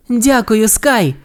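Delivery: high grateful call. A narrator's reading. Ace thanks Sky after the rescue, grateful high call. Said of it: "крикнула Ейс".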